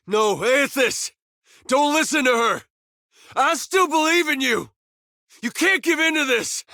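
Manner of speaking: high, strained cry of protest; desperate urging. Acted. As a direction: call out urgently, pleading not to give in. Same plea, alternate line.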